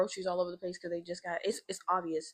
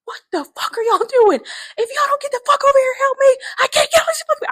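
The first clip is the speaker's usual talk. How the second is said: high, full-force furious yelling; cussing everybody out; 13 dB louder than usual. Retold or imitated. Rescuer to bystanders filming instead of helping, screamed cussing. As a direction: scream at them at the top of your voice. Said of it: "I start cussing everybody out".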